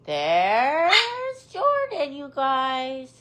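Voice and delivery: drawn-out, rising singsong; high, slow sing-out, stretching the words; singsong announcement. Real, not acted. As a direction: announce the bird in a long singsong. Singsong greeting of a bird.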